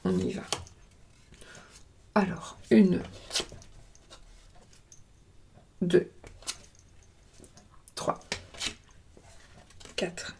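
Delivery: counting cards softly under the breath; barely voiced, counting out cards; hushed counting. Real, not acted. (compter tout bas)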